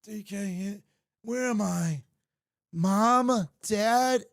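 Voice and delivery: carried off on a stretcher, calling for parents; hammed-up dazed fan. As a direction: melodramatic concussion victim. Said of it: "I would have been like".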